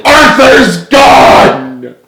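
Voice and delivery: very high, loud, drawn-out wail; bawling uncontrollably. Acted. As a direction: break down wailing. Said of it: "James bawled uncontrollably".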